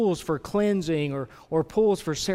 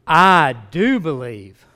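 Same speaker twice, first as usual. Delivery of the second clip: loud, slow, emphatic cry of belief; father exclaiming; 10 dB louder, much slower than usual. Acted. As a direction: cry it out, each word drawn out. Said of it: "the boy's father exclaimed".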